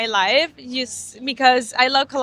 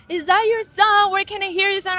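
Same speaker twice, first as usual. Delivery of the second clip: high, eager questions from amazed listeners; mock-excited stranger asking about the song; excited fans; 9 semitones higher than usual. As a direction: voice the fans' excited curiosity, pitched up.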